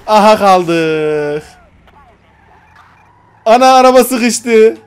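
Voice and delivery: loud, drawn-out wail at the stuck car; loud slow frustrated yell; frustrated loud cry. Real, not acted.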